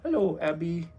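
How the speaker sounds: soft coaxing hello to the dog; soft pet talk. Real, not acted. Owner softly coaxing his dog Abby to greet viewers. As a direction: coax the shy dog gently.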